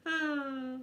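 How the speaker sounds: normal talking voice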